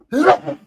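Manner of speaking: sudden loud sneeze on "on". Real, not acted. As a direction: sneeze mid-word. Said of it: "I'm gonna sneeze".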